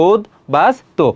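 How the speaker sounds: উদ্বাস্তু in three spaced parts, উদ, বাস, তো; syllable-split pronunciation. Shown as a demonstration. Teacher repeating for students, উদ্বাস্তু slowly split into three. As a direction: leave gaps inside উদ্বাস্তু. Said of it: "সেগুলো তুমি পৃথক পৃথকভাবে লিখবে".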